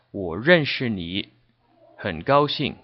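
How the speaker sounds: 我认识你 很高兴 said slowly with separate words; slow choppy demo of 我认识你 很高兴; slow and careful. Shown as a demonstration. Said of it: "to say it more fluidly".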